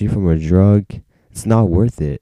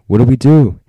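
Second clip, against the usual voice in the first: loud, raised, alarmed question; mock panic; 5 semitones higher, 9 dB louder than usual. Friends facing a bad trip, acted panic.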